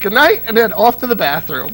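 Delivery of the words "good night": high, loud hurried radio sign-off; Winchell sign-off. Retold or imitated. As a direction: rush the sign-off to get off air. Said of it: "He would finish his show".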